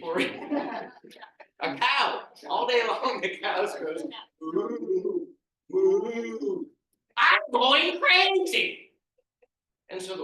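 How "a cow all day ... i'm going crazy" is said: loud, high rant ending in a slow wail; frantic rant. Acted. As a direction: lose it completely. Peter continues ranting.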